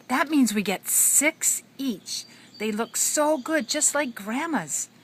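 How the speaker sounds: put-on high, slow eager kid voice; excited kids voice. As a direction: voice the hungry children. Kids voiced in read story.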